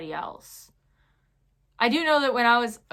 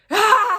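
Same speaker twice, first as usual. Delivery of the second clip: loud, high burst of a yell; mock battle scream; 12 semitones higher, 12 dB louder than usual. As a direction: let out a sudden lunging shriek. Acts out lunging at the kid.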